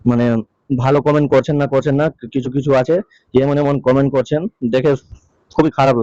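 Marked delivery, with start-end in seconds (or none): none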